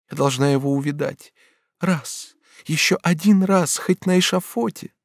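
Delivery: very high, urgent pleading; desperate Batilda. Acted. Batilda begs Bonifas to take her to him, desperate. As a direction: implore him, breaking off and pressing on.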